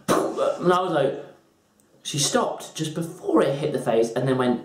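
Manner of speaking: ordinary delivery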